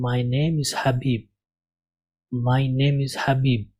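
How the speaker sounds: "My name is حبیب" read slowly and cleanly as a model; careful teacher's model reading; slow correct reading. Shown as a demonstration. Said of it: "جب میں پڑھوں گا تو اس کو پڑھوں گا".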